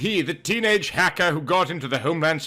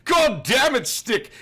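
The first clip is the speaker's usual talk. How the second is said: higher, exasperated curse; angry outburst; 7 semitones higher than usual. Acted. Bruce cursing at Stick in frustration. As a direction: let the frustration explode. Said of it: "he burst out".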